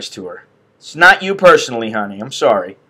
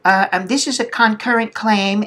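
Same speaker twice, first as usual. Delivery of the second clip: lightly voiced clerk, higher pitch; a hint of a higher official voice; imitated billing lady; 6 semitones higher, 4 dB quieter than usual. Billing lady returns with news, lightly imitated voice. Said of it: "she comes back and she goes".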